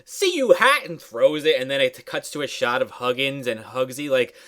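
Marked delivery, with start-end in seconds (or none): 0.0-0.8 high put-on farewell; pitched-up mock cheery send-off; gleeful grandma. Grandma throws her hat away, high mock-gleeful voice.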